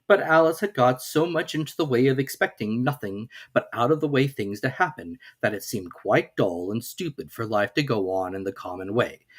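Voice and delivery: plain reading voice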